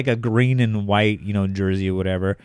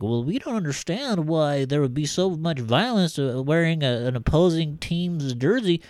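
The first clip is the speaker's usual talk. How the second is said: mocking podcast voice; 6 semitones higher than usual. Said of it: "I'm like".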